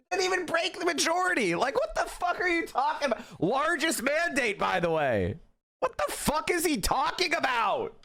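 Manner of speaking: high-pitched, incredulous ranting; worked-up disbelief, pitch climbing; exasperated rant. Real, not acted. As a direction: vent in disbelief at the claim. Ranting at Trump's mandate claim.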